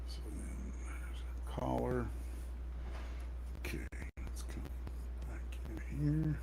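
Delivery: soft whisper while drawing. Real, not acted. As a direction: whisper to yourself over the sketch. Quiet muttering while drawing.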